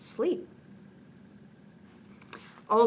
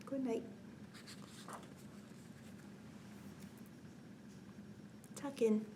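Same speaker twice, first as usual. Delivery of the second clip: soft goodnight; 3 semitones higher, 13 dB quieter than usual. Real, not acted. Saying goodnight to kids.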